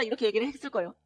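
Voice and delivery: usual speaking voice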